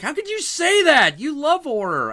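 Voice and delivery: high, disbelieving protest; high, hurt objection from the girlfriend; incredulous. Retold or imitated. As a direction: imitate her shocked disbelief. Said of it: "And she's like".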